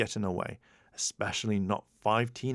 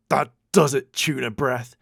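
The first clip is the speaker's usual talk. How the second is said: louder angry snarl; 3 semitones higher, 8 dB louder than usual. A narrator's reading. Bulk snarling at Skull after the dunking. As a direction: lose your temper at your soaked pal. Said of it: "then snarled at Skull".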